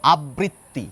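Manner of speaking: আবৃত্তি said as abriti, one light t; single t pronunciation. Shown as a demonstration. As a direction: demo of the common one-t form. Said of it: "একটি গোষ্ঠী মানুষ বলেন".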